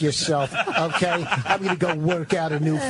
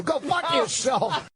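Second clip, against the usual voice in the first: mock shout; 8 semitones higher, much slower than usual. Mock insult at cohost.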